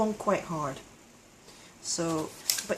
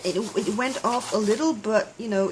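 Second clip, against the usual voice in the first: usual speaking voice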